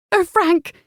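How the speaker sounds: high, loud wail. Acted. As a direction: wail his name in grief. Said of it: "Roseanne wailed".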